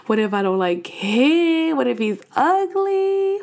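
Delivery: slow, stretched worried questions climbing high; hammed-up anxious what-ifs; acted nervous whining. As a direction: draw out each fear into a whine. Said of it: "I'm so freaking nervous".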